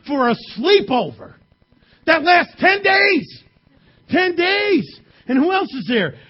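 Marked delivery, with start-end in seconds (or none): 2.0-5.0 emphatic shout. Real, not acted. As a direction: shout it slow and high. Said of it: "emphatic shout".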